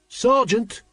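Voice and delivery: high urgent call. A narrator's reading. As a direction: call him, pressing. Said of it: "Carrot repeated urgently".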